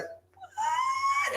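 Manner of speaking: high squeaky shocked what; shocked squeak. Retold or imitated. Nicholas reacting to the sorceress, high shocked squeak. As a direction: squeak it out in disbelief. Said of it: "And Nicholas was like".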